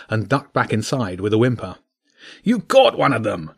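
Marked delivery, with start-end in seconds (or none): 2.4-3.5 raised, booming king's roar; roaring tyrant king. Acted. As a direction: bellow it like a gruff badger king. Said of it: "the king roared".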